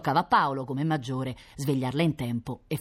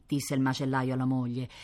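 ordinary delivery, nothing marked

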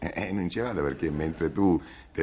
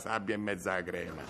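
normal talking voice

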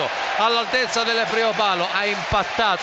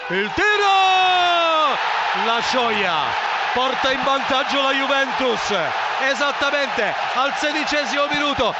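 screamed goal call; 4 semitones higher than usual. Real, not acted. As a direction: hold back, then explode on the shot. Buildup then screamed goal.

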